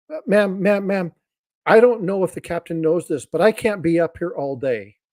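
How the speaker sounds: a lightly voiced worried passenger; blonde passenger. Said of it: "she goes".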